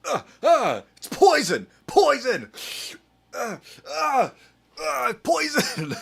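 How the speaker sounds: high, loud hammed-up shrieks; mock panic. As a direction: squeal it like a melodrama. Mock panic over a drink.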